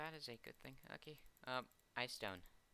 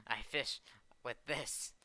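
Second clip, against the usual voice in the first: ordinary talk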